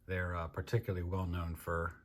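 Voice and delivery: ordinary delivery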